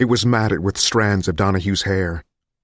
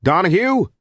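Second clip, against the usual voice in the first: high questioning call; pitched-up holler for someone out of sight; 11 semitones higher, 4 dB louder than usual. A narrator's reading. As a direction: call a name across the hall. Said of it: "Friedman called from across the hall".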